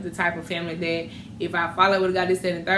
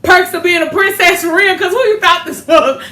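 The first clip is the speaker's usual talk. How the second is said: high, loud triumphant tease; gloating sass; 8 semitones higher, 9 dB louder than usual. Retold or imitated. Daughter gloating to her mother after dad takes her home, high and loud. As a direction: crow over her like a princess.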